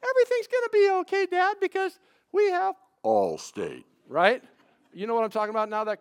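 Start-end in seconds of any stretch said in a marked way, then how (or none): 0.1-3.8 put-on little girl voice. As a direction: play the sweet little daughter reassuring her dad. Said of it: "that comes out of her mouth".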